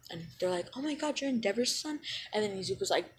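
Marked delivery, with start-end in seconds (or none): 0.7-2.0 high, hushed gasp of a question; shocked classmates. Retold or imitated. Classmates stunned to learn his father, high shocked gasp. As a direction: gasp out the discovery.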